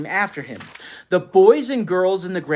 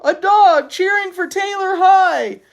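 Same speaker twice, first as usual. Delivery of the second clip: put-on very high, loud girlish squeal; girl's squeal; 10 dB louder than usual. A narrator's reading. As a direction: squeal it with delight. Girl squealing about dog.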